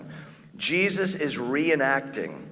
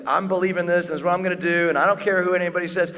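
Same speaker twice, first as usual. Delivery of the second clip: loud, high, fast defiant boast; hammed-up stubborn rebel; rebellious defiance; 6 semitones higher, 5 dB louder, faster than usual. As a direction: defy everyone, mocking rebellion. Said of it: "in a spirit of rebellion".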